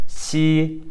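xi held long on a high level tone; pinyin X demo. Shown as a demonstration. Teacher showing pinyin X, demonstrating xi. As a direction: hold xi out, between sh and s. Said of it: "pinyin X is pronounced".

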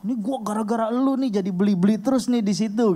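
complaining friend. Retold or imitated. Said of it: "terus dia bilang".

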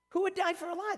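high-pitched, rising at the end; incredulous question. Shown as a demonstration. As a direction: repeat it high, as if it's absurd. Same question repeated in disbelief.

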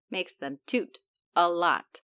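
ordinary delivery

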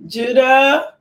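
a sudden loud call across the room; loud rising call to her son. Real, not acted.

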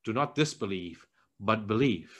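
soft and low, kindly firm; gentle rebuke. Retold or imitated. Jesus gently telling doubting Thomas, soft and low. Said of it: "But then Jesus also says".

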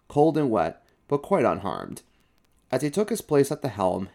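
ordinary delivery

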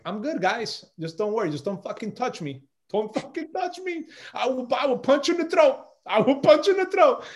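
rising retold threat. To the staff in the room, a rising high-pitched warning. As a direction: pitch climbs high, half-laughing warning. Warning people not to touch.

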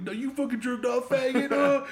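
high-pitched mocking retort; mock jeer. Friends turning on the one who admitted it, mock jeer. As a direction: throw it back as a jeering accusation. Imagined friends jeering back at him.

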